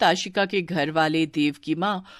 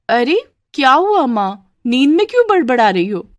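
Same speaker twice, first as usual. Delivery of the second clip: raised, louder startled question; puzzled daughter; 6 semitones higher, 5 dB louder than usual. Acted. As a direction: ask a sleep-talking mother what is wrong. Daughter startled awake questions mother. (चौंकी हुई बेटी)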